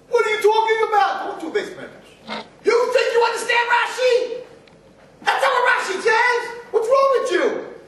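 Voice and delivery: high, loud mock yelling at a study partner; yeshiva shouting match. Teenage yeshiva boys yelling at each other over Rashi, loud. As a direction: yell like you're winning the argument. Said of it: "You'd be screaming at each other".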